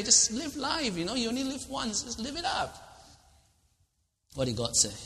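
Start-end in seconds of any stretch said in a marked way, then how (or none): 0.0-2.7 high, breezy and careless; carefree. Acted. Preacher mocking the worldly motto, high and breezy. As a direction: toss it off like you have no worries.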